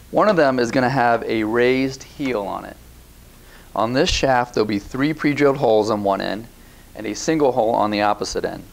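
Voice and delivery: ordinary talk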